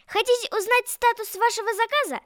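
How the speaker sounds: ordinary talk, nothing marked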